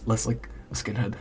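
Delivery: normal talking voice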